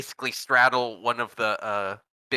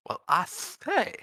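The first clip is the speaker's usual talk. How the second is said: Southern gentleman voice; 6 semitones higher than usual. Shown as a demonstration. Player shows the table his kobold's voice, hammed Southern gentleman. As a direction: ham up a blustering old Southern gentleman. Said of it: "Nox speaks like".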